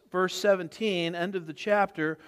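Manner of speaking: normal talking voice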